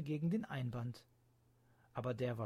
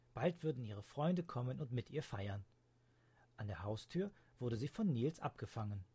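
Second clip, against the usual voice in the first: ordinary delivery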